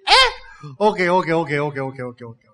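lightly voiced God relenting, trailing off; God giving in. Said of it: "he will hear and say".

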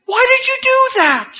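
high loud mock outburst; exasperated Peter. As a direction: throw up your hands as fretting Peter. Peter's frustrated question voiced.

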